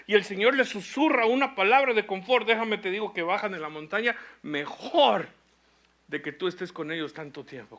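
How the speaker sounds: high, loud impassioned preaching; fiery pastor exhorting the church; preaching at full force. Acted. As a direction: drive the point home to the congregation. Preaching climax.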